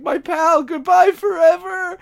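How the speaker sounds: high, drawn-out hammy lament; mock tearful farewell. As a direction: act out crying over a lost friend. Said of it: "Jimmy's crying over his body".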